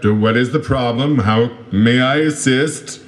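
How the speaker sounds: pompous detective voice. Acted. Hungover detective acting pompous.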